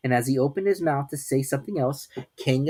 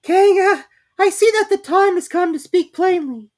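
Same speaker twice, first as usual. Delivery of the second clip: high, loud squeaky attempt at bravery; 10 dB louder than usual. A narrator's reading. Piglet to Kanga, trying to sound brave. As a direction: puff up and sound brave though small. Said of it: "in a brave a voice as he could".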